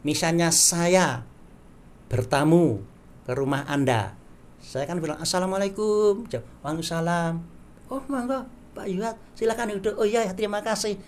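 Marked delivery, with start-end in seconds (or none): 5.2-11.0 spoken greeting. Shown as a demonstration. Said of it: "itu bahasa percakapan".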